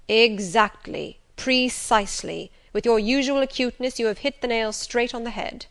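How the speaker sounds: a hint of an emphatic master; emphatic Rochester. Voices Rochester's emphatic agreement.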